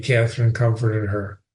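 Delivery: ordinary delivery, nothing marked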